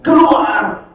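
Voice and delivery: loud burst on keluar; harsh banishment. Retold or imitated. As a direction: bark out the expulsion. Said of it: "Yang Belis dikatakan".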